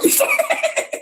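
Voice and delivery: very high, squealing laugh; real high-pitched burst of laughter; gleeful laugh. Real, not acted. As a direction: crack up in a high giggle. Laughs after kicking people out.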